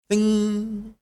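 high, sung magic chime; magic ding. Acted. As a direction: chime it like a spell. Magic sound of the wish.

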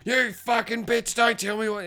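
high, strained mock rage; hammed-up fury without real volume; going psycho. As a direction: imitate a man snapping, drawing out the insult. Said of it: "he starts going psycho".

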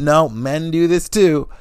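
usual speaking voice

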